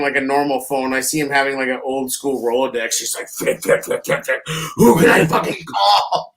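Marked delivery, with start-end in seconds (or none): 3.3-4.4 whispered flip, flip, flip; a frantic coach thumbing a Rolodex, whispering; mock flipping whisper. Juan flipping through his Rolodex. 4.8-6.3 desperate mock plea. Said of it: "desperate as fuck calling Peters".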